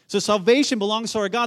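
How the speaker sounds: high, impassioned preacher's cry; screamed proclamation. Acted. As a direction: proclaim it fervently for the crowd to hear. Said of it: "They're screaming that out".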